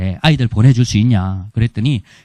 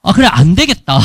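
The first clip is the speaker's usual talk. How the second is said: ordinary talk, nothing marked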